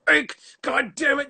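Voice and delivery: high, loud, fed-up outburst at the giggling cast; exasperated director yelling. Retold or imitated. Director yelling at giddy actors, loud exasperated outburst. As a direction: shout it like a director who has lost patience. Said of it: "he said".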